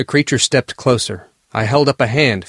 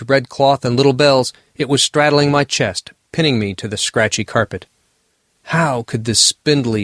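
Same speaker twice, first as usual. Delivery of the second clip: plain reading voice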